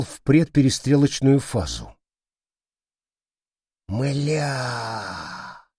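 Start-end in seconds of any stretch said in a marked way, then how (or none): 3.9-5.7 long, soft, stunned exhale; whispered curse. A narrator's reading. Soldier realises the money is lost, whispering. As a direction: let the curse trail out under the breath. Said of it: "прошептал Козёл".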